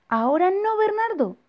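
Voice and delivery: very high, pained dismissal; high squeal. Acted. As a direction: squeal it out while brushing him off. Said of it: "dijo el papá de Bernardo".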